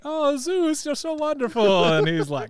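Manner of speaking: hammed-up Greek accent, high; bad Greek accent. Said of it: "really bad Greek accent".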